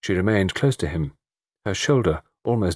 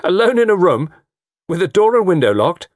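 loud, higher scoffing question; 11 semitones higher, 7 dB louder than usual. A narrator's reading. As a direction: scoff loudly in disbelief. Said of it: "Marshall gave a short laugh".

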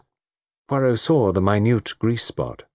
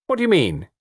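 mildly angry, higher retort; young woman mildly angry at Poirot's hint; 12 semitones higher than usual. A narrator's reading. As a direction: snap back with mild anger. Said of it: "she asked angrily".

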